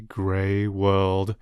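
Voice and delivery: ordinary delivery, nothing marked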